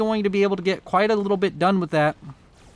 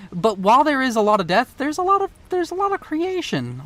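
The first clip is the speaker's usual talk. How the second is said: excited; 7 semitones higher than usual. Real, not acted. Philosophical swing to creation.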